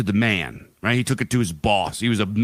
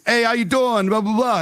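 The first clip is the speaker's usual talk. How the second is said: high, bright mock-friendly hello, much higher; friendly Tony greeting; 11 semitones higher than usual. Tony greets the nervous rich neighbors, high mock-friendly hello. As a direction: cheerily greet them like a harmless neighbor. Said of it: "He's like".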